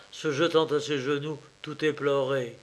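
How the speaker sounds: ordinary delivery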